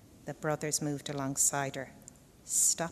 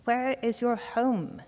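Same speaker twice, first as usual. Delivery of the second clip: suspicious crow; 7 semitones higher, 5 dB louder, slower than usual. A narrator's reading. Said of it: "suspicious at the slug brother's lack".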